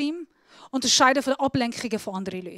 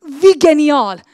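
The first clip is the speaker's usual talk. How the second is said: Wie genial called out much louder, a bright burst of joy; delighted exclamation; 2 semitones higher, 12 dB louder, slower than usual. Real, not acted. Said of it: "Am Anfang ist es mega cool". (Wie genial laut und begeistert ausgerufen)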